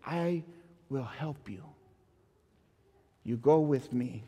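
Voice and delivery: soft, tender reassurance; a soft promise to someone frightened. Retold or imitated. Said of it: "and I said".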